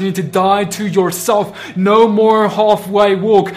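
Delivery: loud, pleading preacher's cry; fiery preaching. Acted. Preacher urging brothers and sisters to choose, loud fiery sermon. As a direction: drive each question home at full force.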